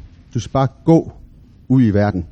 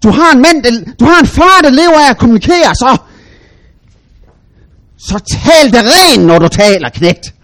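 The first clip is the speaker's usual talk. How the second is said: loud, high angry yell; shouted; 9 semitones higher, 7 dB louder than usual. Retold or imitated. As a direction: shout down at the little boy. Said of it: "jeg råbte". (far råber højt ad sin søn)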